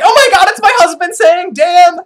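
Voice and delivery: high, loud mock squeal; excited recognition. Wife recognising her husband's voice, excited mock cry. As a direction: cry out in hammed-up amazement.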